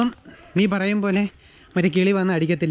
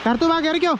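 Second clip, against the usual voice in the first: calling a pet; 10 semitones higher than usual. Real, not acted. Calling the bird inside.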